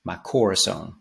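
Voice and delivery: "corazón" with English-style first-syllable stress; anglicized stress on COR. Shown as a demonstration. Teacher demonstrating the anglicized lyric, "corazón" stressed on COR. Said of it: "anglicized way of saying".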